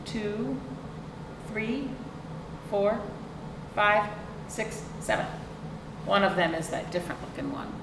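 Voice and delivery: usual speaking voice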